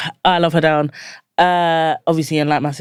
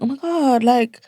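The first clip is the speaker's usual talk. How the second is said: high-pitched mock self-scolding; acted-out dismay at yourself; exasperated self-reproach; 10 semitones higher than usual. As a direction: tell yourself off in disbelief. Acting out scolding herself for attitude.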